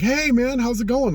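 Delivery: breezy high imitation of a friend saying hi; casual friendly greeting. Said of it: "he was standing there like".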